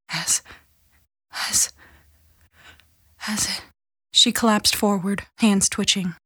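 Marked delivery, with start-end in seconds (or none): 0.1-3.6 faint, broken words with long gaps; fading, halting prayer. A narrator's reading. Dying mother struggles through the Lord's Prayer, faint and halting. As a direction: let each word fail softly, pausing long between.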